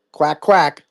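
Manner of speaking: a lightly voiced, reluctant duck quack; grudging duck quack. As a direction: quack it out like a duck under protest. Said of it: "I'll quack like a duck".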